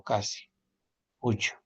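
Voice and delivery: ordinary talk, nothing marked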